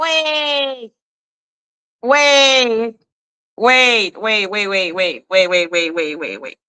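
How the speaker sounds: drawn-out first waits tumbling into a falling string; stunned disbelief. Retold or imitated. Host replaying her shock at the filing, disbelieving descending repeats. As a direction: act out stopping short, repeats sinking in pitch. Retelling own stunned reaction while reading.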